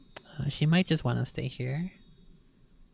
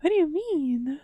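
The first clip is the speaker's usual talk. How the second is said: high playful voice; 10 semitones higher, 8 dB louder than usual. Real, not acted. Talking to the cat, louder.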